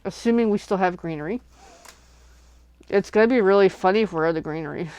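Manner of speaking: ordinary delivery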